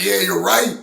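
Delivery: high, loud acted enthusiasm; excited agreement. Band all agreeing with their singer, in mock excitement. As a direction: burst out agreeing, hammed up.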